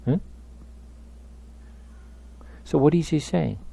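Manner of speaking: ordinary delivery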